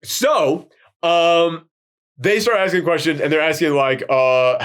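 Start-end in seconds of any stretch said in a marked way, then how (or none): none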